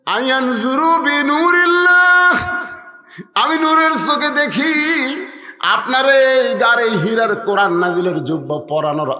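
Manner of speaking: high, loud, sustained sermon cry; full-force preaching. Acted. Preacher repeating Fatema's words to the Prophet, full-force preaching. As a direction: ring the quote out high and long.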